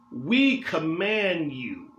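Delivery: stretched out very slowly, each word weighed; slow emphatic command. Acted. Preacher stressing that Paul's command allows no option, slow. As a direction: let "We command you" land slowly like a verdict.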